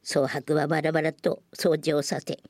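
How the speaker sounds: usual speaking voice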